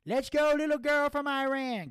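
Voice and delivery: high, loud put-on cheer; root for her in a high, hammed-up voice; Gator. Friend cheering on the champion, high loud put-on voice. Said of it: "Gator was like".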